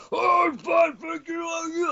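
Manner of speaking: very high, shouted cartoon Alex Jones; Alex Jones impression. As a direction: shriek the greeting in a squeaky mock voice. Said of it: "Fucking Alex Jones is on the line".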